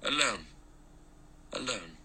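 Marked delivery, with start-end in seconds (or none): 1.5-2.0 very soft, falling repeat; hushed echo. A narrator's reading. Vera's word comes back from the rocks, much softer. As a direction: let it fade like an echo.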